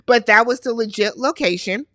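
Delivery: ordinary talk, nothing marked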